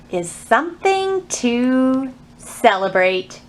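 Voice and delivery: slow storybook read with long pauses; reading to children. Acted. Librarian reads a picture book to children, slow storytime voice. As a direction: savor each page like storytime.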